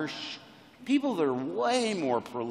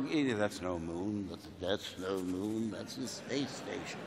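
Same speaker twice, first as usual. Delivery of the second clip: low, slow, hushed mentor voice; grave old mentor; 8 semitones lower, 8 dB quieter, slower than usual. Acted. Obi-Wan sighting the Death Star, low and grave. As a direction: intone it softly and gravely. Quoting Obi-Wan's film line.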